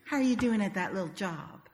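sugar-coated condescension; sweetly discouraging. Retold or imitated. Southern family member belittling Meg's little project, sweetly. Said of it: "discourage one another sweetly".

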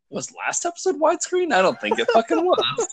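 puzzled squeak at my own TV; baffled. Retold or imitated. Recalling watching Titans, confused by the screen shape, laughing. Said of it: "I was just like".

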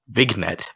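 "Vignette" echoed as vig-net, hard g; imitated mispronunciation. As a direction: quote back "Vignette" with the g sounded. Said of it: "What did I say?".

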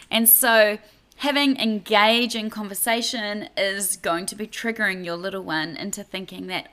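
ordinary delivery

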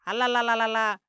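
mock ululating la-la-la; mimicked ghost yell. Veerraju mimicking the vanishing ghost, mock ululation. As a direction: imitate the ghost's cry, short of a full yell. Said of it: "అని అరుస్తూ".

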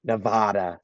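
"Nevada" with a broad "ah" in the middle; stressed middle of "Nevada" rhyming with "spa"; outsiders' pronunciation of Nevada. Shown as a demonstration. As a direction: say "Nevada" as nuh-VAH-duh. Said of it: "however people want to pronounce it".

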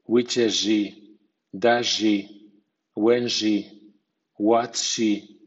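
"where she" with s merged into sh. Shown as a demonstration. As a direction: turn the s into sh before "she". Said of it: "Mutación de s a sh".

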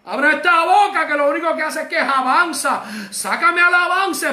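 high-pitched, impassioned preacher's prayer; fervent plea. Shown as a demonstration. Fervent prayer continuing the cry.

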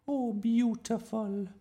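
high, drawn-out admiring teacher; rapturous sigh. A narrator's reading. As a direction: sigh it with slow delight. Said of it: "sighed Miss Darling".

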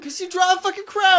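high, loud indignant yell; exasperated shout. Real, not acted. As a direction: shout it back in disbelief. Defending crowns, exasperated.

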